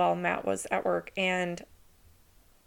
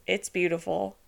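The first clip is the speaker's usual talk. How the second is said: normal talking voice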